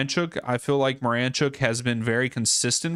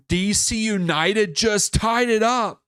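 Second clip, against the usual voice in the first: higher, louder and slower, a stunned announcement; excited; 8 semitones higher, 4 dB louder than usual. Real, not acted. Fan watching live scores announcing an equaliser, excitedly. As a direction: blurt the news out, then repeat it in disbelief.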